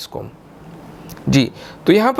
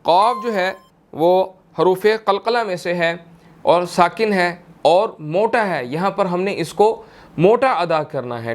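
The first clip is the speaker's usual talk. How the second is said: normal talking voice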